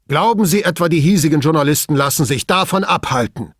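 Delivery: loud, high indignant cry. A narrator's reading. Boss challenging Brunetti about local journalists, indignant. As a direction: call it out in outrage. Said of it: "rief Pater entrüstet".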